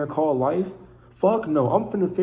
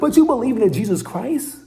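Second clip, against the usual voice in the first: incredulous mockery; 9 semitones higher, 6 dB louder than usual. Mocking disbelief at others.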